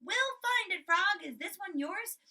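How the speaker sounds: high, loud cheerful reassurance; bright hopeful Frog voice. Retold or imitated. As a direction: chirp it out like an upbeat friend. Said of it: "but Frog the whole time said".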